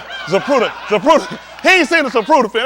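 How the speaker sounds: loud, laughing shout of disbelief. Real, not acted. Laughing loudly at a friend who never saw the film. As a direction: crack up while yelling the name.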